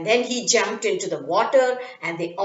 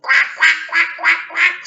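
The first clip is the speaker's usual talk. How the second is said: squealing ducks; 6 semitones higher than usual. Acted. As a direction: quack like fleeing ducks. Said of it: "squealed the ducks".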